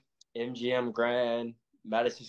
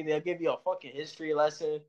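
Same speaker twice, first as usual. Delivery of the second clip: ordinary talk, nothing marked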